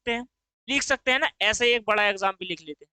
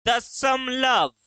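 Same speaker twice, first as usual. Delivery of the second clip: तीन, a pause, then दशमलव drawn right out; slow demonstration reading; much slower than usual. Shown as a demonstration. Teacher dictates a reading to examine, very slowly word by word. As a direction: stretch दशमलव slowly, set apart from तीन. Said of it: "कैसे पढ़ दिया?".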